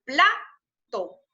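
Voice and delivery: teacher's demo of "Plato", first syllable stressed. Shown as a demonstration. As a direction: punch the PLA of "Plato", then let TO fall. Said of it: "Aquí hay un acento prosódico en plato".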